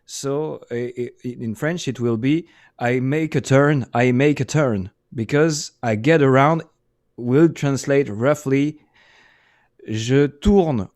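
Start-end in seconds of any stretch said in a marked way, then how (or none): none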